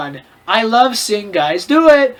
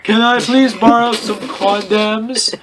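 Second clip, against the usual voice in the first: mock growl; slower than usual. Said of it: "He growled angrily".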